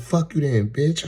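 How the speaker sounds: high, loud mock child outburst; imitating angry kid. Little girl after he turns her down, mock-angry loud voice. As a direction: cuss him out as a stroppy little kid.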